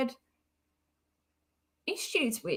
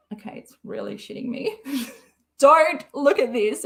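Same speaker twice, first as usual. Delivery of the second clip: ordinary talk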